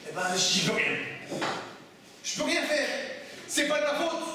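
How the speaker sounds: mock whine of someone dodging blame; whining excuse. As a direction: play the helpless kid who says it's not his fault. Teacher acts out a student's helpless excuse.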